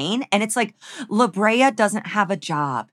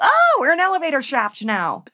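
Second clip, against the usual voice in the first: dazzled brain voice; 6 semitones higher than usual. Retold or imitated. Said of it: "And their brains go".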